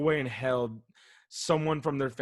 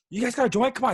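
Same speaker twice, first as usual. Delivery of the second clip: put-on excited pushy kid; 10 semitones higher, 5 dB louder, faster than usual. Kid asking for a joint.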